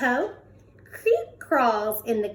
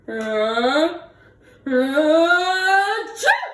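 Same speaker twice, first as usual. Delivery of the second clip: building ah-ah-choo, louder at the end; big sneeze; 5 semitones higher, 5 dB louder, much slower than usual. Acted. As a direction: wind up and blast the sneeze. Bear sneezes from pepper.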